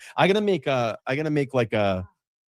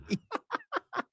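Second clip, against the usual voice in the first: laugh; 11 dB quieter than usual. Real, not acted. Laughs hard at request.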